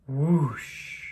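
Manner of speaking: gentle exhaled whoosh; blowing out breath. Acted. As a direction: blow it out like candles. Blowing out imaginary candles again.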